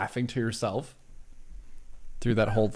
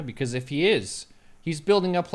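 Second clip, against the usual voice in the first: ordinary talk, nothing marked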